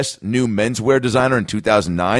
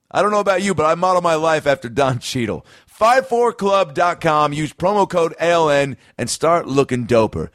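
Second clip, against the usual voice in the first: hyped ad read; 5 semitones higher than usual. Acted. Host pitching sponsor shades to listeners, hyped ad read. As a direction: sell it like a radio spot.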